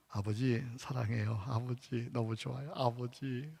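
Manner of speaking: soft, low loving call to Father; hushed, sincere words of love; tender prayer. Shown as a demonstration. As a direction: murmur it like a child to a father. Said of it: "내 마음의 상태가 다 표현될".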